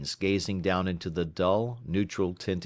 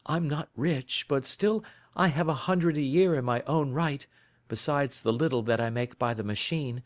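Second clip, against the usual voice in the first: higher, a touch of earnest pleading; a flustered typist, lightly played; lightly voiced anxious young woman; 9 semitones higher than usual. Young woman begging the detective for help, higher and mildly anxious. The visitor pleads her case.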